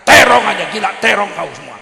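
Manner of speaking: loud, scornful shout about eggplant; hammed-up brat yelling at mom; a son yelling at his mother over eggplant; mock yell at mother. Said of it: "mamanya dibentak-bentaknya".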